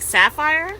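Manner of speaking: high, loud questioning disbelief; incredulous. Retold or imitated. Telling co-host who the account reminded her of, incredulous. Retold shock at troll's identity.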